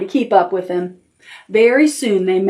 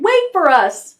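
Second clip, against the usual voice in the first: high-pitched little duckling plea; crying out; 10 semitones higher than usual. A narrator's reading. Ducklings to Brown Rabbit, high plea to wait. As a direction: call out high to be waited for. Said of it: "they cried".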